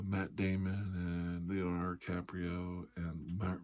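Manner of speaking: ordinary talk, nothing marked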